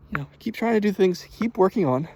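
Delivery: usual speaking voice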